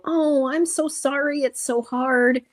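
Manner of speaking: high mock pity, oh so sorry; sympathetic coo. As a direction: coo like a pitying friend. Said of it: "I just wanted somebody to go".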